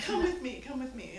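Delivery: eager come-along with a laugh, pitch up; laughing as she waves him along; urging, laughing. Retold or imitated. Mom urges the farmer.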